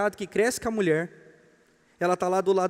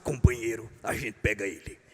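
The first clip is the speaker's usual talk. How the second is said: very low mock union boss; ant leader's conspiring address; 8 semitones lower, 3 dB quieter than usual. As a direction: lay out the plan in a deep leader's voice. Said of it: "O líder das formigas".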